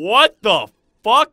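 loud, slow, shocked yell; shocked shout. Real, not acted. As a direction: blurt it out in shock. Sudden shock in game.

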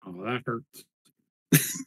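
slow, low wounded sigh; hammed-up hurt feelings; mock hurt. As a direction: pretend to be deeply hurt. Minotaur acts wounded.

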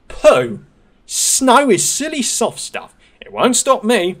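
high, slow scoffing engine voice; cocky little engine. Acted. Thomas scoffing to his driver, high and cocky.

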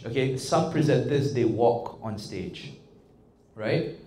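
usual speaking voice

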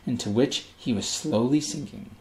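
ordinary delivery, nothing marked

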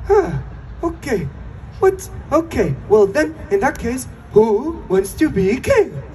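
high, halting put-on accent; Travis. Mimicking Travis in episode two, exaggerated high accent. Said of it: "Travis go like".

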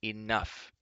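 firm command, easing on the repeat; commanding, first word punched; ordering. A narrator's reading. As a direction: order them to stop. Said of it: "he ordered".

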